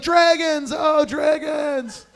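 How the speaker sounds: high, slow hammed-up wail; high parody shout of passion; mock ecstatic cries. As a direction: stretch it out like a lover in climax. Mimicking a lover's cries in sex.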